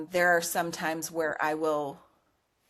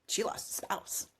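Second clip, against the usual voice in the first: whispered, as if to herself; hushed realization; 10 dB quieter than usual. Retold or imitated. Retelling a private hunch about a client, whispered. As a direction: drop to a voiceless whisper.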